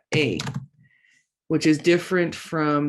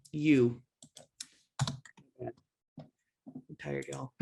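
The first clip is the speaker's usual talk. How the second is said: normal talking voice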